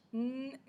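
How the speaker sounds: sustained N on its own; isolated n sound. Shown as a demonstration. Teacher isolates the first sound, "n-" held. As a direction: hum the first consonant "n-". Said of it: "c'est bien le son ne".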